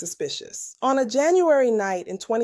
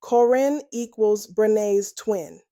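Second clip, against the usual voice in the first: slow, word-by-word spelling out; slow repeat; slower than usual. Shown as a demonstration. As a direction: say it slowly so nobody misses it. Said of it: "Let me say that again slowly".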